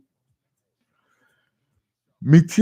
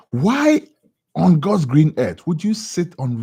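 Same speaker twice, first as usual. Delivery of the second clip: high, loud incredulous why; outraged why; 5 semitones higher, 5 dB louder, slower than usual. Real, not acted. Host reacting to the twenty-four hour economy remark, outraged. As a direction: burst out in disbelief.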